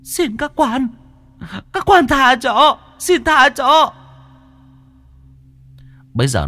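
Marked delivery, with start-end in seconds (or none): 0.0-3.9 very high, loud, slow ghost pleading; begging ghost. Acted. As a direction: beg in terror like a captured spirit. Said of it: "nó mới bàng hoàng". (giọng ma cao vút, hoảng sợ van xin)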